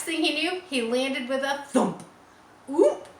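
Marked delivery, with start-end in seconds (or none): 2.7-3.1 a sharp, louder little grunt on landing. A narrator's reading. Pig landing with a thump, grunting. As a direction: grunt it out as he hits the ground. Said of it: "he grunted".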